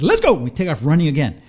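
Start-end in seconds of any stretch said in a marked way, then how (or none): 0.0-0.3 eager rallying call. Retold or imitated. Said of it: "I said".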